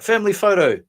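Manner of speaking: high, loud sing-out; mock calling out. Relative calls everyone for a family photo, calling out.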